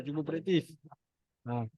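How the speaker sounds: ordinary talk, nothing marked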